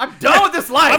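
loud, high mock outburst; hammed-up exasperation; fed-up yelling. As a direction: yell it like you're quitting for good. Acting out fed-up officer's outburst.

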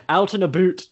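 lightly mocking Canadian accent. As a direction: mimic the Canadian "oot and aboot" sound. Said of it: "I love the Canadian accent".

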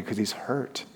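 ordinary talk

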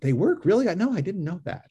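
sarcastic. Retold or imitated. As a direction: play along with fake amazement. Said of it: "let me use sarcasm".

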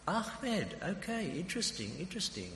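high-pitched, intrigued enquirer; intrigued questioning. Retold or imitated. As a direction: act out keen interest in the driver. Acts out his interested questioning.

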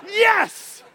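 high, loud "Yes!"; triumphant shout. Real, not acted. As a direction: punch the air with it.

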